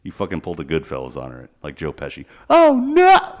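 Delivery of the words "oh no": very high, loud falsetto "Oh, no!"; mock scream. Playing the Target victim, a shrill falsetto cry. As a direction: shriek it like a cartoon victim.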